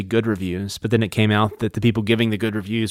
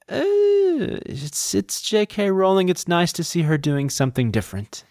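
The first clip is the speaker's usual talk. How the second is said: lukewarm review; 8 semitones higher than usual. Retold or imitated. Host imitating reviewers of a Rowling novel, lukewarm. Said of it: "that was kind of the tone".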